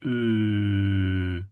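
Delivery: long Ooh with lips pursed to a point; pointed-lip vowel. Shown as a demonstration. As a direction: hold Ooh through tightly rounded lips. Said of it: "the lips come together to a point".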